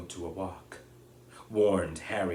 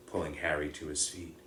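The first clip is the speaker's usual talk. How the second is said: ordinary delivery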